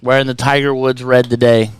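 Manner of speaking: loud ring-announcer roll call of nicknames; announcer send-off. Acted. Host saying goodbye to George for the audience, loud announcer voice. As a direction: hype him up loudly, title by title.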